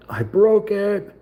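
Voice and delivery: high, slow, whiny I broke it; mock whine. Builder mock-whining to viewers over a snapped piece, high and slow. Breaks a small model piece.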